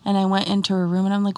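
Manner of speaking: ordinary delivery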